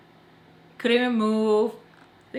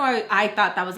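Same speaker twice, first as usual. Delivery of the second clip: usual speaking voice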